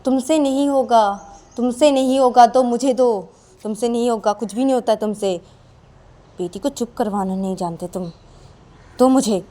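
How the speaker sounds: put-on wife scorns husband and takes the baby from him; scornful wife. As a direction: belittle him and grab the baby. Sakshi taking baby, scornful.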